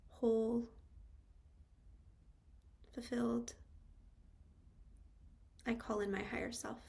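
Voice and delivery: unhurried meditation guide, long pauses between phrases; calm, spacious meditation delivery with lingering pauses; guided meditation. Real, not acted. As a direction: guide them into stillness, phrase by phrase.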